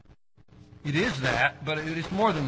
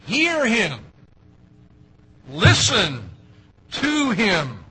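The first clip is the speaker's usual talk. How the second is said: much louder and higher, commanding; thundering command; 4 semitones higher, 8 dB louder, much slower than usual. Acted. God's command to the disciples, thundered by the preacher. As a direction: order them to listen, full force.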